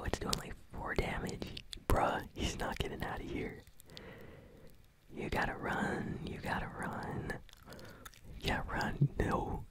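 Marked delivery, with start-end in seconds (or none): none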